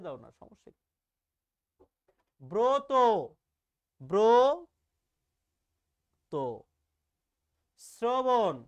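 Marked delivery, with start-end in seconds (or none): 2.4-2.9 ব্রত loud and high, said "bro-to"; o-kar demonstration. Shown as a demonstration. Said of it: "একটা ওকার দিয়ে উচ্চারণ করো". 4.0-6.3 ব্রত very slow, a loud long "brooo", pause, then "to"; syllable-by-syllable o-kar. Shown as a demonstration. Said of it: "একটা ওকার দিয়ে উচ্চারণ করো". 7.8-8.6 শ্রবণ loud, said "sro-bon" in one go; o-kar demonstration. Shown as a demonstration. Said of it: "একটা ওকার দিয়ে উচ্চারণ করো".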